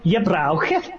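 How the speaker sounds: badly faked Birmingham accent; attempted Brummy accent. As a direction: try Brummy, sliding towards Scouse. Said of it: "the Birmingham bit was good".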